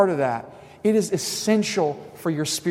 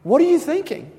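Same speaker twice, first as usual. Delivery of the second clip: imitating Jesus; slower than usual. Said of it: "Jesus is gonna go".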